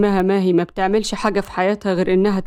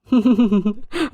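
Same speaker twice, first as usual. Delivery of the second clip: short teasing chuckle; amused laugh. Acted. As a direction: laugh at her jealousy. Amused by her jealousy.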